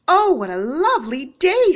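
put-on a bright, happy girl; cheerful girl. A narrator's reading.

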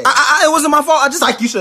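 high, loud stammering excuse; guilty child blurting, high and loud; panicked kid. Acted. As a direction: stammer out a frantic denial.

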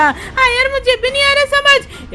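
very high little cartoon voice; squeaky companion. Small companion agrees they are baffled, squeaky puzzled voice.

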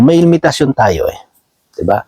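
ordinary talk, nothing marked

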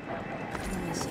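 slow breathy whisper. Acted. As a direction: whisper it as a sad farewell. Whispered farewell at parting.

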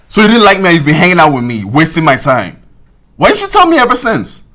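indignant outburst building to a high-pitched demand; angry shouting. Acted. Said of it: "stop shouting".

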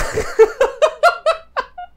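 a sudden squealing laugh; high burst of laughter. Real, not acted. Laughing at the idea of a phone call.

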